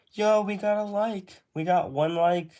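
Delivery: high, excited burst of celebration; excited cheer. Real, not acted. Streamer spots a new like after losing viewers, excited cheer.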